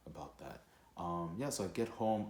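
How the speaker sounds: ordinary talk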